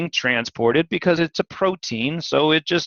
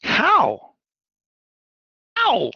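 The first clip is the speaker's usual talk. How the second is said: emphatic how; much slower than usual. Shown as a demonstration. Teacher repeats how emphatically.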